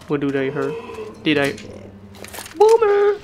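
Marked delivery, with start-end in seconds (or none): 2.6-3.2 high loud yell; shouted taunt. Real, not acted. Player taunting a teammate, high loud yell. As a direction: call it out mockingly.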